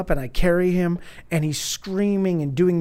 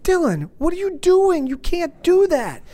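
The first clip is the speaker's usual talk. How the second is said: alarmed call; 12 semitones higher than usual. Retold or imitated. Father calling out to his climbing son, alarmed and high.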